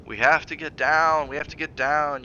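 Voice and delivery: husband mocks his wife's words, sneering; mocking repetition. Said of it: "You keep saying that".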